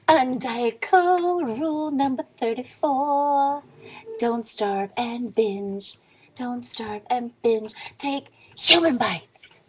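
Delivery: stretched-out, put-on slogan voice; mock announcer. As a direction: intone the rule like a grand announcement. Announcing her rule as a slogan.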